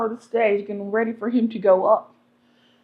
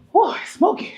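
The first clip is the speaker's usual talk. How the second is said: earnest pastor; 8 semitones higher than usual. Retold or imitated. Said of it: "He said".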